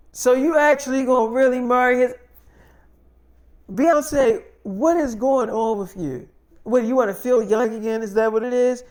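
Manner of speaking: loud, high-pitched disbelief; outraged mother. Retold or imitated. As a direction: imitate an appalled mother confronting her daughter. Mother challenges daughter on marriage.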